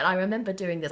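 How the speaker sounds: ordinary talk, nothing marked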